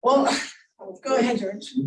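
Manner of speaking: ordinary talk, nothing marked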